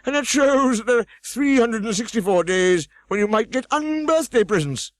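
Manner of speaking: Humpty Dumpty scolding. A narrator's reading. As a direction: snap at the girl from the wall.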